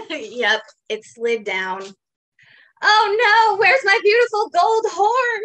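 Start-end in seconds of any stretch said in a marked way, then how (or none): 2.8-5.4 much higher, louder hammed-up wail; a horse panicking over a lost horn, overplayed; mock cry of dismay. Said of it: "cries Steve".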